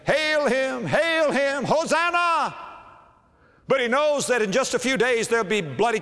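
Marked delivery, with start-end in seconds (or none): 0.0-2.5 high ringing cheer of the crowd; crowd acclaim. Retold or imitated. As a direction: call it out like the Palm Sunday crowd. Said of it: "that crowd is saying".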